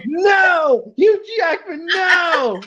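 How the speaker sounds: high shrieking protest; mock outraged shout. Ladies shouting at him for his Hugh Jackman remark, high mock outrage. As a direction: shriek it like an offended fan. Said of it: "They're like".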